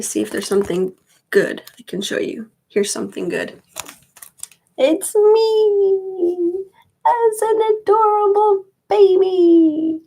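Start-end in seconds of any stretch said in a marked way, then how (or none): 4.8-10.0 high, drawn-out sing-song; playful cooing. Real, not acted. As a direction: coo it way up high, stretching the words. Showing own baby picture, cooing.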